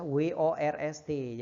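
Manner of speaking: W O R S T spelled out letter by letter; letters of worst said one by one; spelling out letters. Shown as a demonstration. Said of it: "the worst ya".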